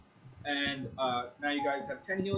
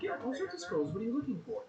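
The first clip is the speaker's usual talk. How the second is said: higher, bright shopkeeper lilt; 6 semitones higher, 4 dB quieter than usual. Acted. Game master voicing the shop owner, lightly higher. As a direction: play the eager merchant.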